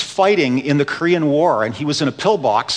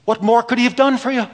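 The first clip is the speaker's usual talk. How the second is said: put-on broken Irish accent; 8 semitones higher, much faster than usual. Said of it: "in her broken Irish accent".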